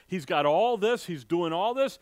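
normal talking voice

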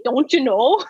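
Minnesota accent with long O's; playful Minnesota demo. Shown as a demonstration. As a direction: say it like a North Dakotan, O's drawn out. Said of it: "the Minnesota O".

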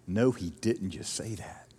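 shocked whisper under the breath. Retold or imitated. Churchgoer whispers in shock at what was just said in the meeting. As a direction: hiss it to the person beside you in the meeting. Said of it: "somebody said something like".

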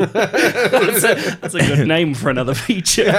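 high, loud laughing remark. Real, not acted. Co-host joking about a feature name, laughing. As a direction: talk through real laughter. Reacts through laughter to phrase.

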